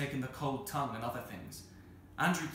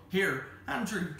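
slow, raised call for attention; 7 semitones higher, 5 dB louder, slower than usual. Acted. Maid calls Andrew from the kitchen door, raised voice. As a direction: call him over, voice lifted.

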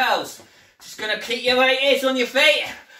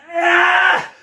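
strained shout; 3 semitones higher, 5 dB louder than usual. Real, not acted. Strain during push-ups.

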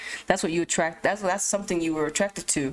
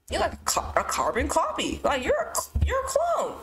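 mock squeal; 8 semitones higher than usual. Mocking a lookalike as a clone.

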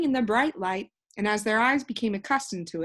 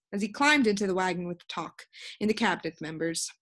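ordinary delivery, nothing marked

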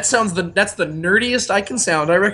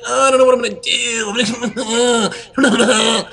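higher, dithering mock king; whiny Theoden impression; 4 semitones higher, much faster than usual. Theoden dithering before battle, mock whine. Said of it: "he's like".

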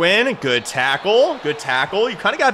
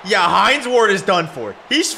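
high, slow, emphatic outrage; angry rant; 5 semitones higher than usual. Real, not acted. Gamer firing his receiver mid-game, angry rant. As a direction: tear into him and cut him loose.

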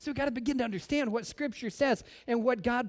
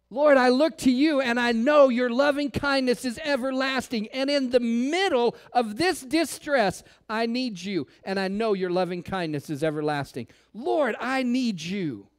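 heartfelt prayer; 4 semitones higher, 4 dB louder than usual. Acted. Pastor voices a prayer.

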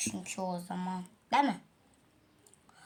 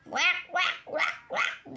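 a light, higher mock "Vak, vak" quack, fading to breath; bird call imitation; 7 semitones higher, much slower than usual. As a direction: lightly copy the bird's honking call. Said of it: "diye bağırıyor böyle".

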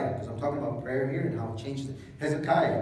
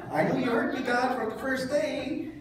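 mock relieved prayer; 10 semitones higher than usual.

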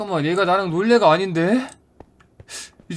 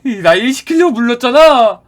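annoyed; 6 semitones higher, 6 dB louder than usual. Acted. Realizes he was used.

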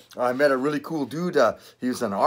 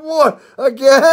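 high, loud disbelieving what again; laughing mock shock at another arrest; mock surprise; 13 semitones higher, 11 dB louder, slower than usual. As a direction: act stunned at being jailed again. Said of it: "I'm like".

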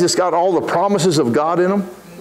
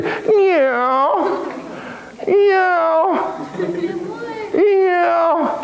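high, insistent mock meows; a hungry cat staring you down through the glass; cat meowing for food, high and pushy; demanding cat meows; 9 semitones higher, much slower than usual. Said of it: "He's looking straight at you".

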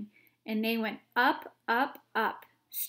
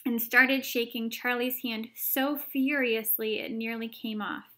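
usual speaking voice

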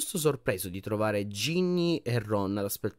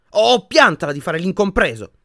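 sharp, loud and higher; cutting; 7 semitones higher, 10 dB louder than usual. A narrator's reading. Hermione telling Harry to stop sulking, cutting. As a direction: cut him down to size. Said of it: "disse Hermione tagliente".

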